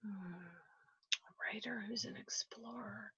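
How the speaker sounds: barely audible whisper to yourself; thinking aloud. Real, not acted. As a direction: puzzle over it under your breath. Guest puzzles over clues to herself.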